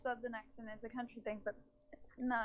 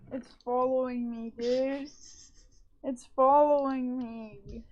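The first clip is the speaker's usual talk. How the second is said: louder, slow panicked wail; 7 dB louder, slower than usual. Real, not acted. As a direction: plead as it chases you. Fleeing the creeper in game.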